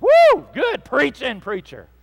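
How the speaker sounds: high, loud mock amen-corner holler; imitating an excited church member; congregant's cheer. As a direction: whoop your approval of the sermon. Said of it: "somebody right then should have said".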